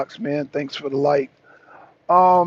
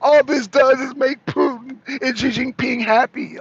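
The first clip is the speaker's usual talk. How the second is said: high mock worried voice; mildly whiny mocking quote; mocking whine; 7 semitones higher than usual. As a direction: parody a fretting politician. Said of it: "she's like".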